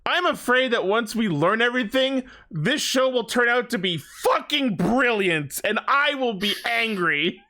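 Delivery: ordinary talk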